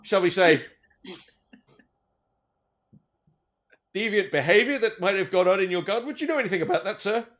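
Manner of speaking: officer at the door, formal and careful; elf police officer. Acted.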